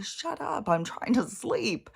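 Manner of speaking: high-pitched mock exasperation, last words drawn out; exasperated complaint. Mimics own complaint at the noise.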